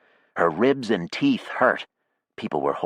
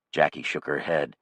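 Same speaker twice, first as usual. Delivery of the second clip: ordinary delivery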